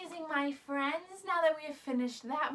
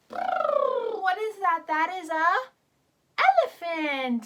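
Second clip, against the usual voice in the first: higher, slower, much louder; big excited elephant reveal; 5 semitones higher, 9 dB louder than usual. Shown as a demonstration. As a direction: wonder at the reveal. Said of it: "reveal to children".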